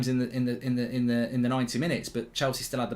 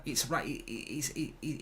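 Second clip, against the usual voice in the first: ordinary delivery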